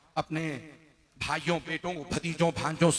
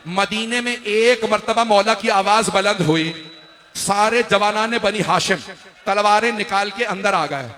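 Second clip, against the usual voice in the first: loud, higher sermon cry, building; impassioned preaching; 5 semitones higher, 9 dB louder than usual. A narrator's reading. Preacher rouses mourners toward Ashura, loud impassioned recitation. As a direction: build the narration to a high, loud pitch.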